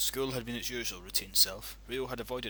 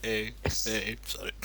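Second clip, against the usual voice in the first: normal talking voice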